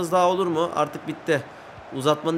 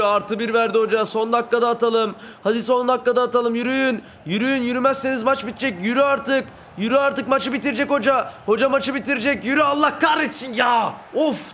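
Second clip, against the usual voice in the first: frantic urging shout; 6 semitones higher than usual. Real, not acted. Gamer yelling at his team in the last minute, frantic shout.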